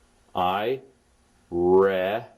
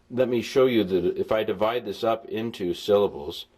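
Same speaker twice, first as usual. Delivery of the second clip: normal talking voice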